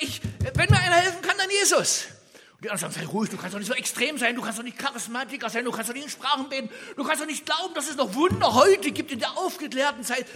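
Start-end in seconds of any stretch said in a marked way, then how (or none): 0.0-2.1 high, loud, fast desperate cry; a beggar shouting that only Jesus can help; loud plea. Retold or imitated. Blind man cries out for help. 3.0-10.2 fast, loud scolding with a scornful edge; mocking rebuke. Crowd mocks the shouting man.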